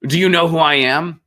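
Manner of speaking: imitating a pompous protest; self-important indignation. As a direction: pull rank like an offended star. Retold argument, celebrity pulls rank.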